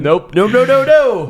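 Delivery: high, loud run of refusals. Pretend. Host rejecting the deal talk, loud high refusal. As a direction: shout the co-host down.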